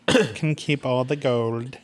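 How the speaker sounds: slow, drawn-out mock decree; game master proclamation. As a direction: announce the challenge in a put-on, stretched-out voice. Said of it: "Be like".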